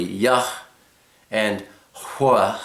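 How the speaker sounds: "Yah" and "Hua" as separate syllables; two syllables. Shown as a demonstration. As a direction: set "Yah" apart, then blow "Hua". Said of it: "It's two syllables".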